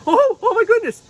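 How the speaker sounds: very high, loud alarmed unicorn; little unicorn finding her mane singed; startled unicorn puppet. Pretend. Unicorn puppet reacting to the fire, high and loud. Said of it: "Miss Sylvia's like".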